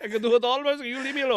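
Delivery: high, loud, quick mock Italian boy; Italian accent. Italian accent: Nero to his mama, high and loud. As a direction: ham up an Italian kid's whine. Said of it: "he's Italian".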